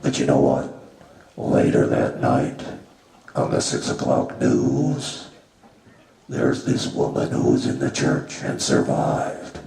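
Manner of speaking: ordinary talk, nothing marked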